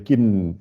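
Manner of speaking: "kin" closed on a held n; added final n. Shown as a demonstration. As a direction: linger on the n ending "kin". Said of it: "on rajoute le N E".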